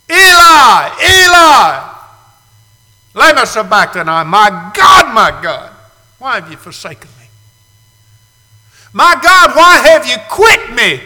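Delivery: high, loud anguished cry of the forsaken. Acted. Preacher crying out as Jesus on the cross, anguished and loud.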